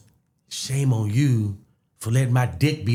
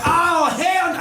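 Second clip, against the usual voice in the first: higher, louder put-on outrage; faked anger; 6 dB louder, slower than usual. Faking anger at the ex to save face. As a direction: pretend to be mad. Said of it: "I gotta act mad".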